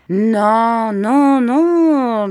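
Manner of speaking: loud, high, drawn-out refusals; emphatic dismissal. A narrator's reading. Miss Betsy dismisses mother's fears, emphatic. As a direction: brush the panic aside at full voice.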